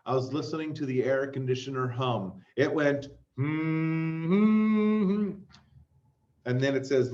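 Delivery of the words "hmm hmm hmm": flat humming imitation; humming drone. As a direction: hum like an air conditioner. Imitating air conditioner hum.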